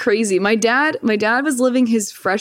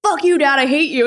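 put-on teen outburst; 7 semitones higher than usual. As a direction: snap it like an angry teenager. Retelling her angry post.

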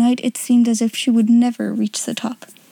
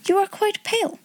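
put-on higher, worried girlish voice; concerned young girl; 10 semitones higher, 4 dB quieter than usual. A narrator's reading. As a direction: fuss over a tired friend. Ermengarde voiced high.

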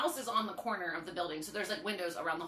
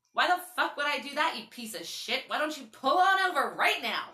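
loud, higher angry telling-off; cussing him out; 5 semitones higher, 5 dB louder than usual. Retold or imitated. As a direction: chew him out loudly. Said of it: "I, like, cussed him out".

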